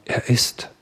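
a soft, breathless, unvoiced start; faltering whisper. A narrator's reading. The young man falters before the angry crowd, whispering. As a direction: whisper it, lost for words.

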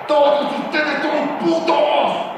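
high, slow, drawn-out insult; angry outburst. Acted. Coach revealing what his press cliché really means, angry outburst. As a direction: let the real meaning burst out.

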